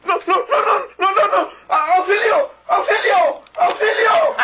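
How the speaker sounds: high, loud screams for help; panicked screaming. Acted. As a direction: scream in terror for someone to come. (gritos de pánico pidiendo auxilio)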